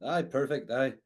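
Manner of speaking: normal talking voice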